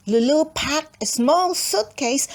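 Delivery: usual speaking voice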